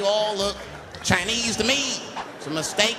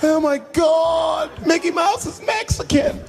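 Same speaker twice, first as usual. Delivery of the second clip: high, loud mock screaming, stretched out; crowd screaming; 13 semitones higher, 4 dB louder, slower than usual. Parkgoers horrified at the mascot, hammed-up high scream. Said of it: "Everybody was screaming".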